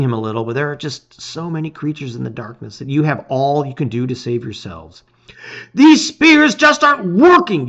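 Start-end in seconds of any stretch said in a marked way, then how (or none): 5.7-7.7 high, loud shout in battle. A narrator's reading. Hero shouting to friends mid-battle, loud. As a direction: yell it over the flapping wings. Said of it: "you shout".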